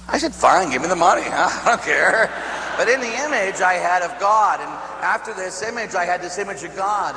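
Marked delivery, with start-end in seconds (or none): 0.3-2.3 loud, throw-up-your-hands surrender; exasperated giving in. Retold or imitated. Grandson giving in to his crying grandmother, exasperated. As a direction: give in loudly, dragging the last words.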